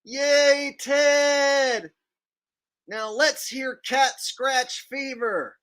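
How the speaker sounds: high, drawn-out fake cheering; hammed-up high fan voice; mock fan cheer. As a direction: whoop like an overexcited concert fan.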